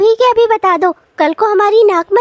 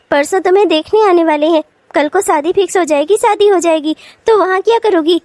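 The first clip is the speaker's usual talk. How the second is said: ordinary talk, nothing marked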